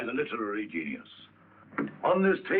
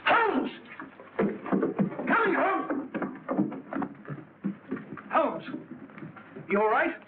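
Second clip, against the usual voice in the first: urgent high shout across a room; anxious call for Holmes; 11 semitones higher, slower than usual. Acted. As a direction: yell his name to find him. Calling out for his friend.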